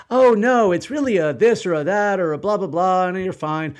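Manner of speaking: high, loud breezy brush-off; mock the doctor waving it away as nothing; imitating reassuring doctor. Retelling what he hoped the doctor would say, loud and high. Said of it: "I expected her to say something like".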